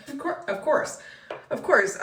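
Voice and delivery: laughing through the words, high and loud; helpless laugh breaking up the phrase. Acted. Agent hearing of a leak at the re-inspection, laughing. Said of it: "sorry for laughing".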